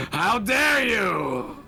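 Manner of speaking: mock outrage. Hammy offence at a friend's joke, mock outraged shout. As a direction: drag out how dare you in hammed-up offence.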